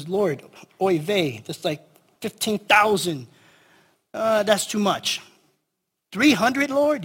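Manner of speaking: put-on slow kvetching Yiddish-accent protest. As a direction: whine to God in a Yiddish accent. Gideon complaining to God, oy vey.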